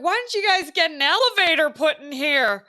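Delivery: hammed-up demanding American tourist; mocking tourist complaint.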